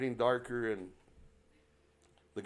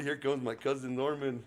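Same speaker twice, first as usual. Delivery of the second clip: usual speaking voice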